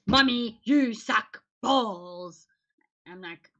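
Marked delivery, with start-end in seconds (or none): 0.0-2.5 put-on slow drawn-out whiny teen; mock teen sob. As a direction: drag it out like a sobbing teen. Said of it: "usually it's".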